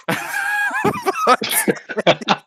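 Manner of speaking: high shrieking burst of laughter; a shrieking laugh at the end of the poem. Real, not acted. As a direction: let out a squealing howl of a laugh. Huge laugh after the poem.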